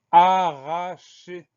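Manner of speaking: "arracher" in three long, separate syllables, loud opening A. Shown as a demonstration. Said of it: "première syllabe A".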